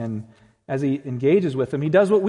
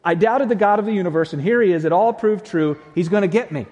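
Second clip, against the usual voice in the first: loud, higher comic panic; mock terrified; 4 semitones higher, 7 dB louder, faster than usual. Preacher as Thomas realizing Jesus heard him, mock panic. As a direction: play a doubter in hammed-up panic. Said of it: "I would have been terrified".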